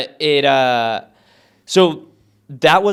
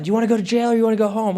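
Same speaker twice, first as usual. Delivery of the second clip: quick, higher-pitched retold ultimatum; movie cop line; 7 semitones higher, much faster than usual. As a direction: rattle off a clichéd cop threat. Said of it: "classic, like, movie line".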